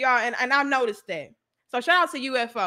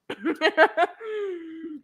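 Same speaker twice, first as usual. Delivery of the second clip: high laugh; real amused laugh; 3 semitones higher than usual. Real, not acted. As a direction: burst of teasing laughter. Laughing at her own tease.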